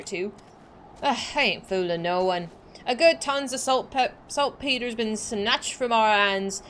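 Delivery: lightly voiced pirate accent for game dialogue; pirate accent reading. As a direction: read the sea captain with a hint of pirate accent.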